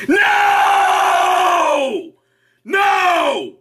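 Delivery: high shouted no; high mock shout. Comedian shouting a high mock no. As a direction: yell it high in mock protest. Mock refusal after laughing.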